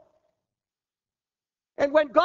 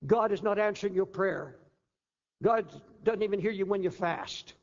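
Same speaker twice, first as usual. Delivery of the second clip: ordinary delivery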